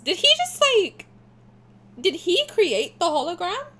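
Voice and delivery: high, loud disbelief; amazed. Real, not acted. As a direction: gasp out the question in shock. Amazed at hologram.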